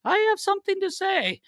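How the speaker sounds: very high imitated announcement; Brez. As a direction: announce it in a squeaky put-on goalie voice. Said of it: "Brez comes a-, around the corner".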